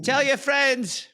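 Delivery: high, drawn-out Scottish send-off; high-pitched Scottish merchant; Scottish accent. Acted. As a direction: call it out slowly as a Scots shopkeeper. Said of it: "do you do a Scottish accent".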